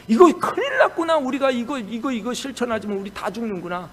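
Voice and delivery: high, loud alarmed cry that settles down; a king suddenly seeing disaster. Retold or imitated. Nineveh's king realizing doom, high alarmed cry.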